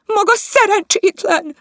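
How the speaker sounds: a high, loud shrieking rebuke; shrieking scold. Acted. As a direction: shriek the insult at the maid. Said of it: "sivított". (visítva szidja a cselédet)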